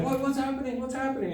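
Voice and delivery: alarmed sister. Retold or imitated. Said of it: "My sister's like".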